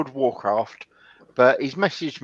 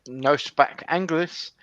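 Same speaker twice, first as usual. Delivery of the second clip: slow, broken English in a fake foreign accent; mock foreign accent; 3 dB quieter, slower than usual. Gamer fobs off a stranger's chat, fake foreign accent. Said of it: "I was in one of those moods".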